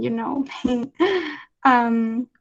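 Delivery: usual speaking voice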